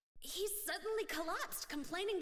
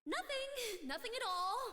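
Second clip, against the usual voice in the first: nervous denial; 7 semitones higher than usual. Acted. Caught by the guard.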